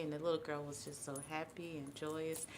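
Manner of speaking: ordinary delivery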